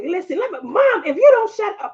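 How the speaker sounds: high, heated warning to mom; a daughter warning her mother in anger; retold outburst. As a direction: replay the blow-up at mom. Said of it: "I was like".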